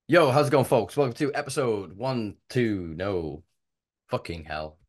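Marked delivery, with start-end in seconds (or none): 0.1-2.9 podcast intro greeting. Real, not acted. Host on take three of the intro, punchy welcome. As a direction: kick off the show to listeners.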